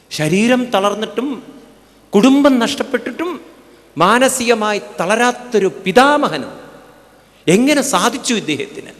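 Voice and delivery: high, loud rising amazement; fervent wonder. Real, not acted. Preacher marvelling at Bhishma's unbroken mind, loud and high. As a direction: preach it out in awe, building.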